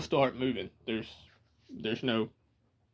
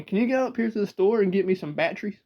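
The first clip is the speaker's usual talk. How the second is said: quick, higher-pitched country granny request; 4 semitones higher, faster than usual. Retold or imitated. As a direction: imitate granny's country speech, rattled off. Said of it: "she'd look at my mom".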